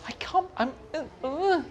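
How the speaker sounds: flustered fan stammering in a high squeak; inarticulate squeaks. Retold or imitated. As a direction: squeak helplessly, lost for words. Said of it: "making inarticulate squeaks".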